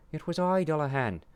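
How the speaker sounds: high, loud confident statement. A narrator's reading. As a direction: announce yourself proudly. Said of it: "stated with confidence".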